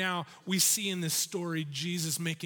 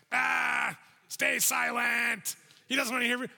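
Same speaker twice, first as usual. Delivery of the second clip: mock shout; 5 semitones higher, 3 dB louder than usual. Shouting at him.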